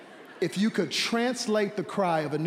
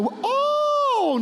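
soaring high "oh"; preacher's sudden rapturous cry; high ecstatic preacher's cry. Real, not acted.

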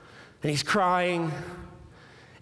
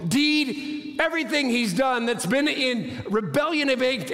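high, sustained, insistent sermon voice; impassioned preaching; 7 semitones higher than usual. Acted. Preacher declaring Jesus is God, impassioned. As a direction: build the argument in a high, driving pitch.

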